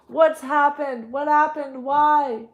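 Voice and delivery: high, loud, slow anguished pleading; anguished questions. Acted. Grandmother grieving Roberto, anguished and pleading. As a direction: beg to know why.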